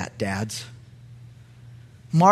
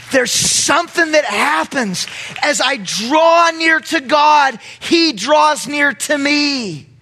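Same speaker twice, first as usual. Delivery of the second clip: high, slow, loud climactic preaching; 10 semitones higher, 4 dB louder, slower than usual. Real, not acted. Preacher's climax on drawing near.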